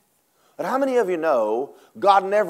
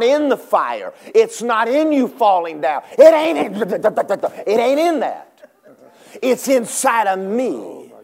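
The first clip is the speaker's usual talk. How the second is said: loud preaching; 6 semitones higher, 5 dB louder than usual. Acted.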